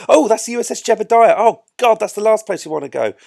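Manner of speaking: high, loud, hurried outburst; exclaimed dismay. Real, not acted. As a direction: blurt it out on spotting a blunder. Spots the wrong ship, dismayed.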